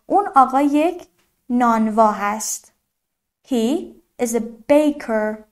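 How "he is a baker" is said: very slow, separated English words; careful teacher's slow demonstration; slow model sentence. Shown as a demonstration. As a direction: model it word by word for learners.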